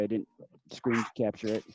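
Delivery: ordinary talk, nothing marked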